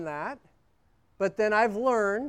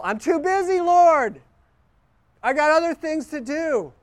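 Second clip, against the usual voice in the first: mock complaint; 8 semitones higher, 5 dB louder than usual. Shown as a demonstration. Voicing an excuse to God.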